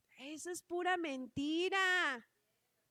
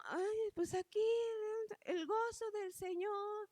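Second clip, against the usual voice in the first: higher, slow, lightly voiced smug sigh; mock complacent; 6 semitones higher than usual. A mild mock of a complacent believer to the congregation, higher and slow. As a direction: play the lazy believer who lets the flame die.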